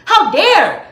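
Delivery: ordinary talk, nothing marked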